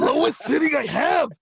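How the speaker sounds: loud fake quiet voice; mock quiet attempt. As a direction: insist it's the lowest setting. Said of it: "they say whisper".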